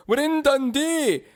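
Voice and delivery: high, slow mock Scottish accent. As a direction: say it like a proud stage Scot. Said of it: "we're gonna be trying this accent".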